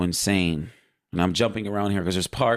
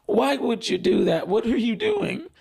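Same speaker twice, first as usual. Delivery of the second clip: much higher, distressed disbelief; emotional Gabriel; 13 semitones higher than usual. Retold or imitated. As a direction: cry out at a brother's betrayal. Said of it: "who was more emotional".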